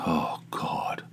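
breathless whisper of dread; whispered groan. Real, not acted. Dreading mowing the lawn in the heat, whispered groan. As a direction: whisper it like you can't face it.

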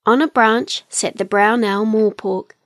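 usual speaking voice

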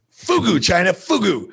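"fugu" and "China" punched out with a swooping fall; exaggerated FUGU. Shown as a demonstration. Podcast guest entertaining himself with his pet words, exaggerated and punchy. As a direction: relish "fugu", "China", "fugu" as separate showy words. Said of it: "But I like saying it".

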